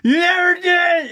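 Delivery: high-pitched, drawn-out fighting scream; yelling. Acted. Costumed Barbie fighting with Ken at a party, yelling. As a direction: shriek it slowly at your partner. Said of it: "it's just those guys yelling".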